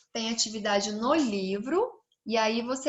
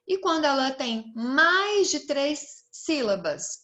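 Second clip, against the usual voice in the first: ordinary talk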